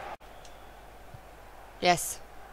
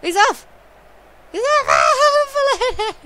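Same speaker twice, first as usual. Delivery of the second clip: rising higher and louder each time; building excitement; 8 dB louder than usual. Real, not acted. Gamer watching his player sprint clear, rising excited shouts. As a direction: climb with the breakaway.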